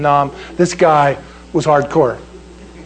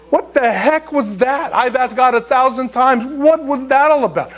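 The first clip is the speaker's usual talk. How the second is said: baffled exclamation; 7 semitones higher than usual. Retold or imitated. Preacher recalls asking God a thousand times, high-pitched bafflement. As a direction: pitch it up in exasperated bewilderment.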